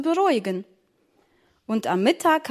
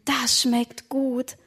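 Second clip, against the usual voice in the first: savouring; much slower than usual. Acted. Tasting the strudel.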